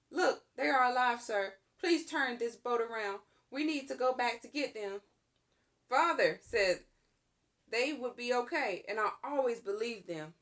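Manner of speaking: put-on pleading boy. To the sailor, a boy pleading to go back for his family. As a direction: beg the sailor to turn back.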